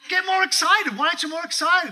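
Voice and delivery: rally them up, loudly; excited dad pushing the boys to react; excited urging. Retold or imitated.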